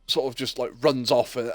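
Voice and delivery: ordinary talk, nothing marked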